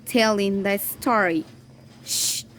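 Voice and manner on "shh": unvoiced "shh" for silence; a soft hush to the listeners; shushing. Hush acted in the story.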